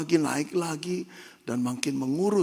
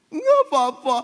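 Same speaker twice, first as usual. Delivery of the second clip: put-on high, loud child's cry; a boy shouting back at his father; child protesting; 14 semitones higher, 10 dB louder than usual. Struggling boy cries out against his father, high and loud.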